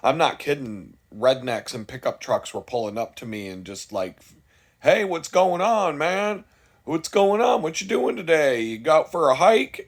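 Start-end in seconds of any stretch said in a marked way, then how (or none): 4.8-9.9 put-on higher, louder overly chummy good ol' boy greeting. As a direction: play the suspicious local sounding neighborly. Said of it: "acting friendly".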